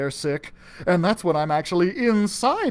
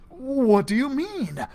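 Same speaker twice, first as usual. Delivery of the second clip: stammering; 6 semitones higher, slower than usual. Acted. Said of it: "I stammered".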